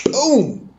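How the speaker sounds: high, drawn-out boom; long falling "boom"; imitated boom. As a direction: voice a big boom sound effect. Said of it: "it was like".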